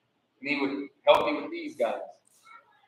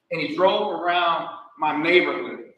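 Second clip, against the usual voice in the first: usual speaking voice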